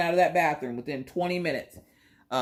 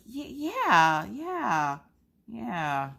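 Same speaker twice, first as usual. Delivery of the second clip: reluctant high yeah; 10 semitones higher, much slower than usual. Retold or imitated. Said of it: "And I was like".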